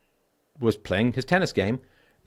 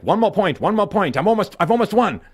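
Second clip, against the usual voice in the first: higher, louder, shouting dad at tennis; retold yell; 8 semitones higher, 6 dB louder than usual. Dad shouting while son is missing, loud and high. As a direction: belt it out like a dad too busy winning to care. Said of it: "and he's like".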